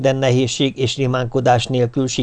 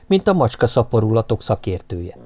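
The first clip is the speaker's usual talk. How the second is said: usual speaking voice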